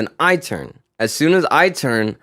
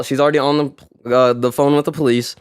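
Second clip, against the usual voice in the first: ordinary delivery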